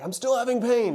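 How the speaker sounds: high, whiny quoted complaint; mock complaint. Patient complaining about lingering pain.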